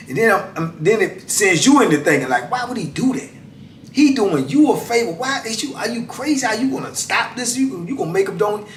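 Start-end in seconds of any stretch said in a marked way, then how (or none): 2.5-8.7 high, disbelieving questions at the absent brother; retold disbelief, pitched well up; incredulous. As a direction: ask it like you can't believe he'd do that.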